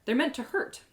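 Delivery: ordinary delivery, nothing marked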